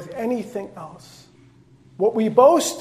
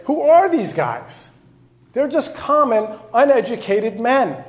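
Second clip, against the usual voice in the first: scornful authorities; 6 semitones higher, 6 dB louder than usual. Retold or imitated. Mocking authorities quoted.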